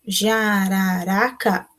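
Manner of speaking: very slow, each syllable set apart. Shown as a demonstration. Teacher showing four syllables to the class, very slowly. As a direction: count the syllables out with your voice. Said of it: "Se tiver quatro".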